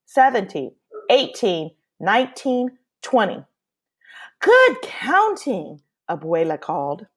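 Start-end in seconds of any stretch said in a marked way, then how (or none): 4.4-5.8 high, slow sing-song praise; drawn-out warm grandmother voice; grandmother's praise. Acted. Abuela praising Pablo's counting, slow and sing-song.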